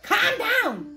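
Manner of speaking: imitating viewers' protest. Reader voicing viewers telling her off, mock-shrieked. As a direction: yelp it as the viewers would. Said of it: "some of you guys are like".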